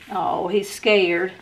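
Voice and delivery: normal talking voice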